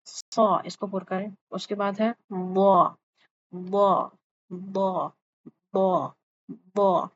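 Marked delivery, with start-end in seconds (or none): 2.3-7.1 وا with the tongue covering the palate; heavy zaad. Shown as a demonstration. Teacher drills the letter for students. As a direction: say وا full, the tongue against the gums and palate. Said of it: "آپ کی زبان نے جڑ کو لگنا ہے".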